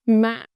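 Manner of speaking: "Mạ" cut short on a quick drop; short heavy tone. Shown as a demonstration. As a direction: hit "Mạ" hard, then drop. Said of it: "short and heavy".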